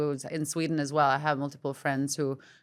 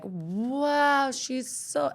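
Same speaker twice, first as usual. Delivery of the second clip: admiring onlooker; 8 semitones higher, much slower than usual. Retold or imitated. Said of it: "everybody could look at your papers and be like".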